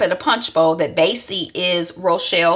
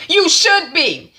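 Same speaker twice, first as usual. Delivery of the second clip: haughty; 11 semitones higher, 4 dB louder, slower than usual. Retold or imitated. Isaiah cold reply.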